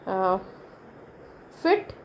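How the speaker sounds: usual speaking voice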